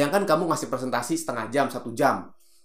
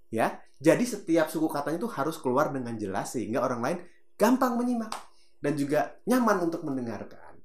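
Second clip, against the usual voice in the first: normal talking voice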